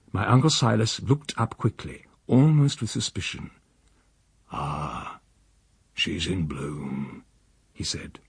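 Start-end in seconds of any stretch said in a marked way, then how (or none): 4.5-7.2 low, very slow, soft drawl; quiet musing. A narrator's reading. Uncle speaks of his lily, slow and hushed. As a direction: linger fondly over each word.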